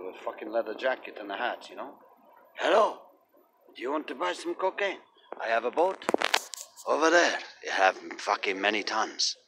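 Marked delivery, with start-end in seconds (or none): 2.6-9.4 mock Spanish accent, pitched up, broken English; hammed-up Spanish accent dealer pitch; stupid Spanish accent. Narrator imitating a Portuguese drug dealer, mock Spanish accent. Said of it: "With a stupid Spanish accent".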